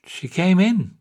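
usual speaking voice

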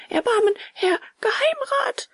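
very high begging puppet; pleading. A narrator's reading. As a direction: implore in a high voice. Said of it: "weinte und flehte". (hohes Flehen um Erbarmen)

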